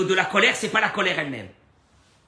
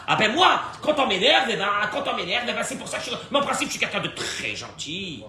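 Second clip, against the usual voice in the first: higher, louder excuse, laughing at it; mocking hothead's excuse; 6 semitones higher, 4 dB louder than usual. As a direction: lampoon the man who blames his temper. Mocking a quick-tempered excuse. (imitation moqueuse d'un colérique qui se justifie, plus aigu et en riant)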